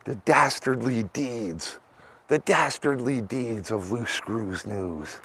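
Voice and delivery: mock-sinister slow announcer; mock dramatic narrator. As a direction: intone it like a villain's title card.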